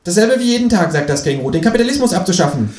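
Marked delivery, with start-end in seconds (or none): 0.0-0.9 high, pushy kangaroo voice; a cheeky high voice for the Kangaroo. A narrator's reading. Känguru nags the narrator to clean the bath, high and pushy. 1.5-2.7 high, pushy kangaroo voice; a cheeky high voice for the Kangaroo. A narrator's reading. Känguru nags the narrator to clean the bath, high and pushy.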